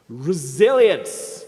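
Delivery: slow, drawn-out loud shout of one word; stern yell. Retold or imitated. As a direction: bark the word like a drill sergeant. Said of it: "rather sternly. I said".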